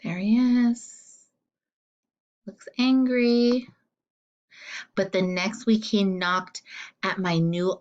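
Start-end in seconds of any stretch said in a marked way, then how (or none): none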